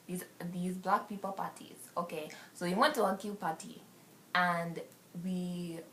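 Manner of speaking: lightly put-on African accent. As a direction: tell the party story in an African accent. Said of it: "I'm gonna go to African".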